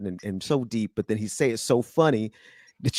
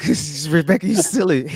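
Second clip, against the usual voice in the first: higher, louder, laughing at a friend; 5 semitones higher, 5 dB louder than usual. Real, not acted. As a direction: tease her through laughter.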